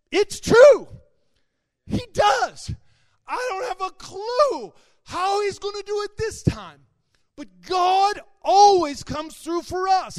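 crying it out as faith breaks through, slowing at the end; tearful shout of faith. Retold or imitated. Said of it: "I burst into tears".

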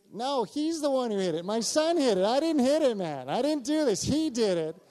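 high, loud protest shifting the blame; comically defensive, pitch jumping up; mock-panicked denial. Dad hastily shifting blame onto son.

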